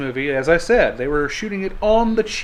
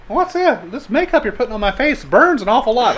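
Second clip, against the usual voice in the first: much higher, plaintive mock starlet; 6 semitones higher, 4 dB louder than usual. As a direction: whine it like a young film starlet. [name] complaining on set.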